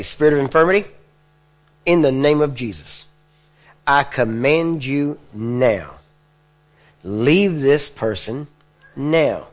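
slow, stretched-out command; drawn-out command. Shown as a demonstration. Preacher demonstrating a drawn-out command to the sick. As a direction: draw every word of the command out slowly. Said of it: "I'm kinda giving it, drawn out here".

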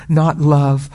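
ordinary talk, nothing marked

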